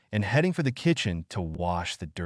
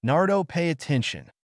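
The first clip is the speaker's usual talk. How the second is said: higher-pitched call for attention; mild cry out; 7 semitones higher than usual. A narrator's reading. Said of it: "Sadie cried, waving her arms".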